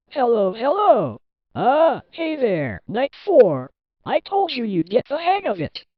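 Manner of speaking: high, lively greeting with stretched openers; upbeat phone caller. Acted. Phone caller greets night guard.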